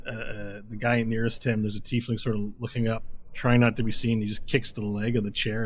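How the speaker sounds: ordinary talk, nothing marked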